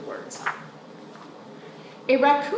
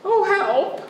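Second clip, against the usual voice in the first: much higher oh help; a small cry for help; dismayed; 13 semitones higher, slower than usual. Acted. Child after the porch crash, higher.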